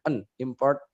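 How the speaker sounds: "important" ending in a bare n after a stopped t; held-back t. Shown as a demonstration. Teacher explaining the held sound, "important" with stopped t. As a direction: hold the t of "important", then just "En". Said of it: "langsung ditahan".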